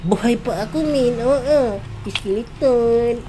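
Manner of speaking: high, loud exclamation; excited boasting. Real, not acted. Player announcing survival to viewers, excited.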